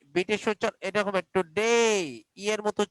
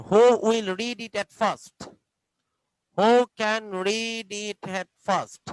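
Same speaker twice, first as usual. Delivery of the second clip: very slow, drawn-out question; slow classroom question; much slower than usual. Shown as a demonstration. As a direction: stretch each word for young pupils. Teacher asking class slowly.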